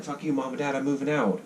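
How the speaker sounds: put-on high, bratty kid voice; a hammed-up kid telling off Mom and Dad; mock teen rebellion. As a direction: fling it at the parents like a teen moving out. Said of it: "We're like".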